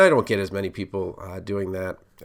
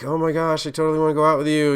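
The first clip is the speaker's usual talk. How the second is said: mock eager gushing, higher and fast; 6 semitones higher, 5 dB louder, faster than usual. An overeager suitor asking someone out, mock gushing. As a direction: play the overeager suitor, hammed-up and quick.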